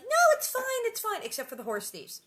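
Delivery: high loud jokey brush-off; hammed-up carefree reassurance; mock breezy Pa. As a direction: toss it off with a wink. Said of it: "he's like".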